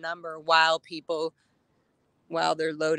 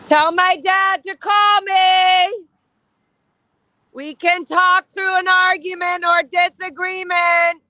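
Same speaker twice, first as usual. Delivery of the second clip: loud, high, strained shout across the street; emotional shout; 12 semitones higher, 8 dB louder than usual. Real, not acted. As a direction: call out with everything, voice cracking. Shouting at Scientology building.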